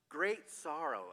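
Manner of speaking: Great sorrow said slow and heavy; slow weighted phrase. Shown as a demonstration. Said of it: "a very passionate way".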